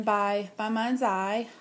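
ordinary talk, nothing marked

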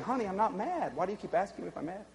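soft reassurance. Acted. Dad answering small daughter on his lap, softly patient. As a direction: drop to a quiet, gentle protest. Retelling gentle reply to young daughter.

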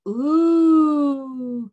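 loud rising ooh of delight. Shown as a demonstration. Teacher showing kids the circus food sound, a loud delighted ooh. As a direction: ooh like you can't wait to taste it. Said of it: "you can make this sound".

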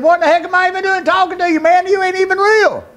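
very high, fast, strained outburst; angry challenge. Retold or imitated. Desperate father telling God off, angry challenge. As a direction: hurl it at God, high and hard.

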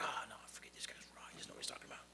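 whispered fast grumble of a bitter man; Luke impression. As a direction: hiss it out fast, under your breath. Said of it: "It's my Luke impression".

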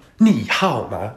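你好吗 rising, dipping, then rising; exaggerated up-down-up 你好吗; fake Chinese tones. Shown as a demonstration. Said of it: "You wanna have that up, down, up".

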